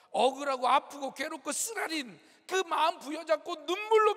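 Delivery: building sermon fervor; fervent preaching. Acted. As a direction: preach the grace with full conviction. Impassioned preaching climax.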